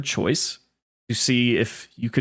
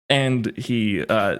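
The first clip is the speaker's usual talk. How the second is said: ordinary delivery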